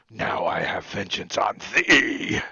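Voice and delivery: low, slow, loud vow of vengeance; vengeful murderer. Acted. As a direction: intone it as a dire curse. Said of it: "and says".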